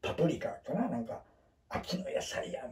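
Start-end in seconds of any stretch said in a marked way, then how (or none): 1.7-2.7 breathy whispered aside, voice dropped right down; whispered quote. Retold or imitated. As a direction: whisper it like a secret.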